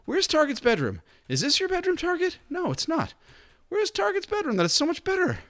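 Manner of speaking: high, squeaky cartoon voice for the jealous colonist. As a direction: pipe up high like a little jealous character. Voicing jealous colonist Shelly scene.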